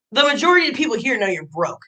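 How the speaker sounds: ordinary talk, nothing marked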